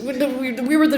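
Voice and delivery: ordinary talk, nothing marked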